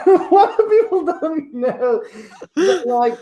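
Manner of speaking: can barely get the words out for laughing; fighting off the giggles. Acted. Still cracking up.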